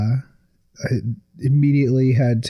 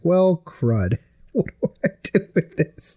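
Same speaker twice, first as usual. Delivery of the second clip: laughing dismay; 6 semitones higher than usual. Retold or imitated. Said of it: "I'm like".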